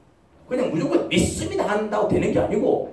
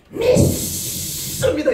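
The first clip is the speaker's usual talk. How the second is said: high, long-drawn hissing cry of faith; mock fervent shout; 9 semitones higher, much slower than usual. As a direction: stretch it out in exaggerated zeal. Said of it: "그냥 목소리로 믿는 게 아닙니다".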